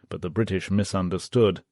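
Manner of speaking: plain reading voice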